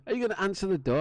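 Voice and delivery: lightly voiced officer pressing; mock officer. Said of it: "He's like".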